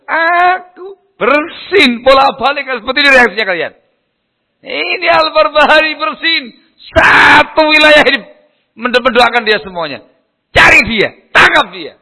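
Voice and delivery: high, shouted tirade, acted out; angry ruler. Jealous ruler orders the scholar's arrest, furious acted shouting. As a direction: play the enraged ruler yelling out orders. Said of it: "Marah dia".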